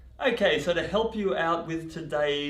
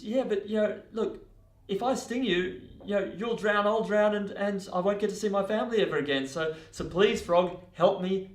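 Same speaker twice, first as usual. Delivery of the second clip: high, reasoning plea; desperate pleading; 8 semitones higher than usual. Acted. Scorpion persuading the frog they would both drown, high earnest pleading. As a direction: coax and beg, pitch held high.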